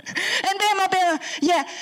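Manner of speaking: put-on high, insistent little girl voice; imitating granddaughter. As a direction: cut in like a pushy little girl. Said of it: "she goes".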